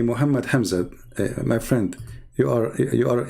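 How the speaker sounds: ordinary delivery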